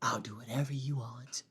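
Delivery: soft, half-whispered pushover voice; hushed, simpering mock surrender; mock submissive. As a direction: murmur it meekly, mocking a pushover. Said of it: "But never, ever say".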